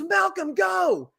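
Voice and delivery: high yelled call to a player; imitated yell. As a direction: shout the name high and sharp. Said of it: "he yells".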